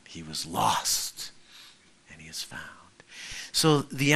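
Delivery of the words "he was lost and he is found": tender hush. Acted. Father's tender words, whispered end.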